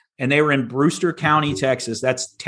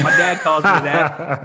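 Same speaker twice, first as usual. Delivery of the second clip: laughing; slower than usual. Real, not acted. Joking retort to landowner.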